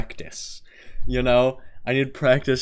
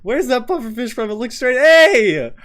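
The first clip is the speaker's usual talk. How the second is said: real high, loud, laughing disbelief; shocked outburst; 11 dB louder than usual. Real, not acted. Streamer reading a mean comment under a video, shocked outburst. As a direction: shriek in disbelief through laughter.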